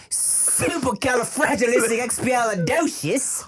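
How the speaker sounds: high, slow hammed-up British flourish; drawn-out comic British chant; mock British accent. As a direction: stretch it out in cartoon British. Parodies British musical English.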